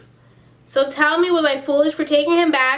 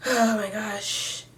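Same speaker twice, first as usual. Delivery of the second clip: exasperated sigh; 5 semitones lower, 7 dB quieter, much slower than usual. Real, not acted. Fed up reading the email.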